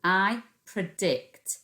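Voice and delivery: I predict drawn out while writing; teacher dictates while writing slowly; slow careful dictation. Shown as a demonstration. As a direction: say I predict slowly as you write. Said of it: "Three Fred fingers".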